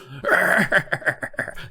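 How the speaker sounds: imitated creepy cackle. Shown as a demonstration. The mask's laugh escapes from Steve, creepy cackle. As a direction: let a wicked cackle out. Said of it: "this cackle".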